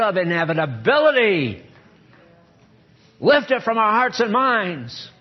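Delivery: rising, loud preacher's prayer; fervent prayer. Acted. Pastor leading the standing congregation in a fervent prayer. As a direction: pray over them with mounting fervor.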